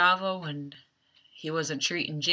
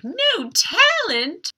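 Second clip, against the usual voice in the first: high, drawn-out mock outrage; upset disbelief; 7 dB louder, much slower than usual. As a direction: repeat it back in loud, high indignation. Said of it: "Kendall gets all upset".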